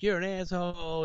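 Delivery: high, mock angry insult from the girlfriend; angry girl. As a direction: toss it off as a shrill-ish retold jab. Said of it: "And she's like".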